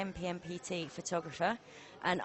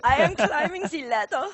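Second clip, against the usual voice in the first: hammed-up German accent, higher and louder, laughing through it; 7 semitones higher, 9 dB louder than usual. Commentator redoes the joke in a louder, laughing German accent. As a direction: go big on a comic German accent. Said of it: "No, the German".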